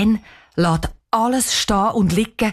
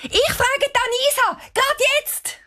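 put-on much higher excited girl calling. A narrator's reading. Cheyenne calls to her parents, high and excited. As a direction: shout it out in a rush of joy.

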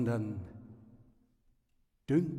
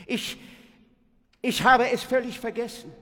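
flustered confession; 7 semitones higher, 6 dB louder than usual. A narrator's reading.

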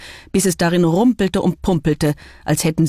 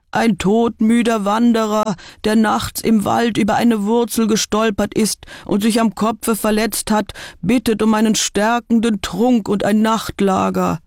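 bittender Wanderer; 5 semitones higher than usual. A narrator's reading. Verstellter Wolf bittet um Einlass.

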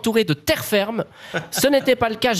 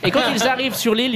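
usual speaking voice

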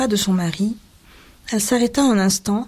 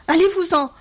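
high, overwrought outburst; agitated cry; 9 semitones higher than usual. A narrator's reading. Wife orders husband out, high agitated cry. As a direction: cry it out, more and more worked up. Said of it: "cria-t-elle de plus en plus excitée".

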